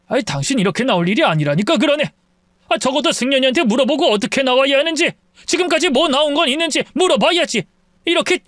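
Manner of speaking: a hint of an urgent, hushed older man; restraining husband. Father-in-law pleading. (아내를 말리는 다급한 장인)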